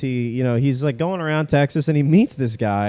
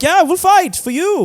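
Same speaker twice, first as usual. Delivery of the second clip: eager soldiers; 5 dB louder than usual. Retold or imitated. Soldiers eagerly offering to fight.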